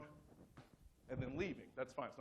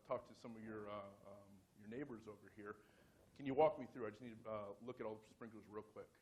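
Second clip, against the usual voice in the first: casual, low-key official patter; playing it cool; 5 semitones lower, 6 dB quieter than usual. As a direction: deliver the cover story smoothly, trailing lower and softer. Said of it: "I played it cool".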